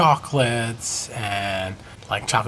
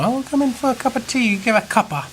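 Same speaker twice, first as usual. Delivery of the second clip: much higher put-on posh English voice; mock British invite; 12 semitones higher than usual. Teasing the English about tea, high mock British accent. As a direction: play a dainty English host offering tea.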